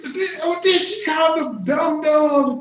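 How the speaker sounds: high, loud shocked shout; indignant outburst. Real, not acted. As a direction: blurt it out in outraged disbelief. Outraged at clip insults.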